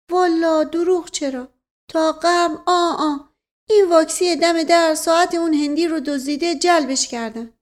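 put-on high-pitched, insistent old retainer; earnest servant figure. Servant defending his understanding to his master, high and earnest.

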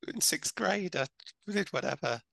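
put-on squeaky scared kid spilling everything; kid voice from The Goonies. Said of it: "the little fat kid".

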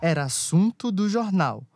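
rhythmic rhyming verse storytelling; cordel recitation. A narrator's reading. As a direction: recite like a folk poet.

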